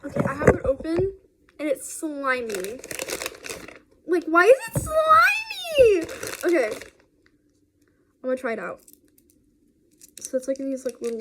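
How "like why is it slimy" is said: squeaky high, slow disgust; grossed out. Real, not acted. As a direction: recoil at something slimy. Disgusted at slimy candy.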